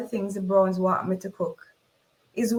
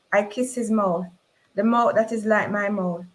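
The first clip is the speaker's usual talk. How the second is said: usual speaking voice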